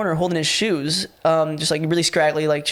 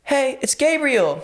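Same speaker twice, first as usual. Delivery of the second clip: higher, slow, timid stranger voice; meek stranger; 9 semitones higher, much slower than usual. Retold or imitated. Intruder introduces himself from the stairs, timid higher voice.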